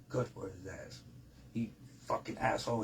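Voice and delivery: very soft, low aside; retold quiet approval. Officer privately siding with the inmate, hushed low aside. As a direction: imitate an officer agreeing under the breath.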